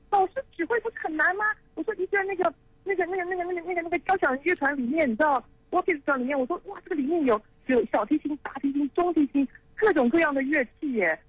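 high-pitched, flustered amazement, stumbling over words; astonished reaction. Retold or imitated. Acts out own astonished reaction to teacher.

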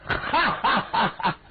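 high, slow booming ha-ha laugh; hearty laugh. Acted. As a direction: laugh warmly at the request. Hearty laugh welcoming request.

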